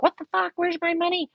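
high-pitched mock outrage; indignant demand. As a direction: imitate a friend demanding an answer. Friend demands money, retold.

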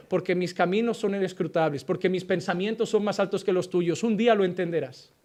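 low, soft, grave voice of God answering. Acted. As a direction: speak as God, quiet and heavy with authority. (voz de Dios, grave y baja)

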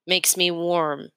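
usual speaking voice

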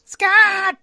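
high loud call. Acted. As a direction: shout the name out. Calls out the correspondent's name.

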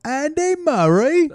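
high, drawn-out posh British put-on; mock a British sports star; hammed-up British accent.

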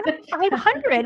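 high, put-on voice for a joking overcount; mock exaggerated confession. Talking to her co-host about mirror checks, high mock voice. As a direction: ham up the count as if it's absurd. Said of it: "I'm like".

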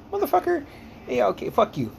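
high-pitched comic indignation; mock-offended retort. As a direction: retell it squeaking up in protest. Retold retort to teasing friend.

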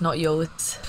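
ordinary talk, nothing marked

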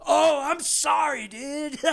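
high, slow, dazed apology; mock raver. Bartender imitating a dazed raver, high and slow. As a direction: drift through it like a high partygoer.

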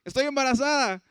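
retold joyful surprise, lifted high with a touch of laughter; surprised mother. Mother bursting in to tell the family she's pregnant, surprised. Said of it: "llega mi mamá y bien sorprendida".

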